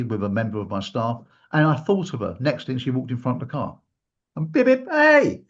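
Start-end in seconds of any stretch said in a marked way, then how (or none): 4.4-4.9 high beeping car horn noises; imitated car horn. Driving in Piccadilly, imitating the horn high. 4.9-5.4 high, loud hey; calling out. Retold or imitated. Spotting her in front of the car, calling high and loud.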